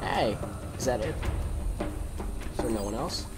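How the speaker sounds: ordinary delivery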